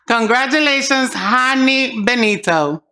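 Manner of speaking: ordinary talk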